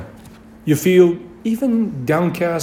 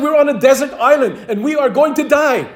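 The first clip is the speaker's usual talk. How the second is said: exasperated; 7 semitones higher than usual. A narrator's reading. Panicked castaway insisting.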